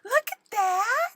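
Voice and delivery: very high squealing coo; giddy, high-pitched squeal over something adorable. Real, not acted. Crafter cooing over the perfectly cute gift, giddy. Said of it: "I'm so giddy".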